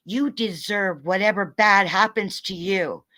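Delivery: normal talking voice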